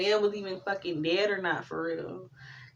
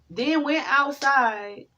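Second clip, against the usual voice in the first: ordinary talk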